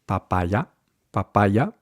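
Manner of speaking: Papaya with unaspirated p's; equal-length syllables in Papaya; muted p, evenly timed Papaya; even Spanish syllables. Shown as a demonstration. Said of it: "keeping each syllable the same length".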